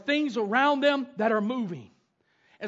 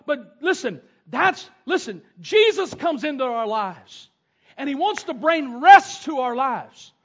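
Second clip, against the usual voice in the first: ordinary talk, nothing marked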